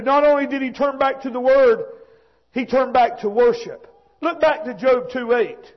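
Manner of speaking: ordinary delivery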